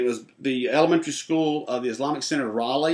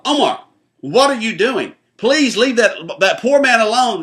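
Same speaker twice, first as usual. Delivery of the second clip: high, loud call across the room, retold; mother yelling; 9 semitones higher, 8 dB louder than usual. Mother calls out to her son bothering a stranger, loud high yell. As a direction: yell it out sharply to stop the child. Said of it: "this woman yells out real loud".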